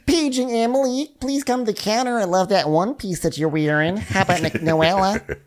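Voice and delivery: put-on high Michigan-accented paging announcement; Nicole. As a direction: imitate a chipper Michigan flight attendant. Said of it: "That's basically what Nicole sounds like".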